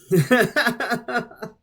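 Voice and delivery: high, loud laugh. Real, not acted. Reader amused by supposed science illustration, laughing. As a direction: burst into a short run of giggles.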